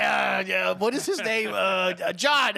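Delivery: a hint of groping for a name, hammed up; mock forgetful host. Teasing co-host about forgetting his name, mock flustered. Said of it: "You're like".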